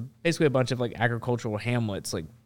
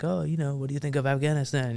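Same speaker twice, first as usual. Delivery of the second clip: put-on higher-pitched French accent; 4 semitones higher than usual. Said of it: "I can do a French accent".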